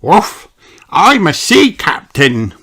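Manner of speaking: high, loud playful pup voice; a little dog playing sea captain; barking puppy Danny. A narrator's reading. Danny playing pirates with friends, loud high pup voice. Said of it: "barks Danny".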